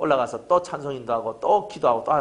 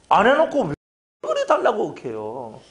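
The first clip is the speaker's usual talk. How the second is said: usual speaking voice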